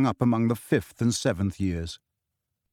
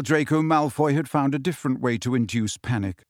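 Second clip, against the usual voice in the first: ordinary delivery, nothing marked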